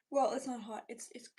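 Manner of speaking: ordinary talk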